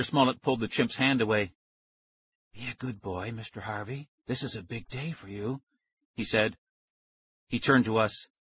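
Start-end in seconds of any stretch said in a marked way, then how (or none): 2.5-5.6 very soft coaxing; soothing murmur. A narrator's reading. Scientist soothes the chimp, very soft coaxing. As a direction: murmur like to a pet.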